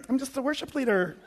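feeble Satan impression. Satan pleading to the warrior angel, mock meek. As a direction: make the devil a meek weakling.

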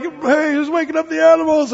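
put-on annoyed kid. Kid woken by the animals, high and cranky. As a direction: whine about the noise like a sleepy kid. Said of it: "and now the kid's like".

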